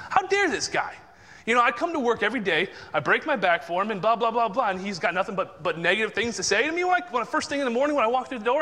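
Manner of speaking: high-pitched, worked-up griping about the boss; retold indignant rant. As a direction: replay an upset inner rant, pitch jumping up. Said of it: "'cause I'm upset".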